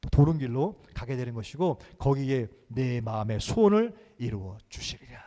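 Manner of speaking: ordinary talk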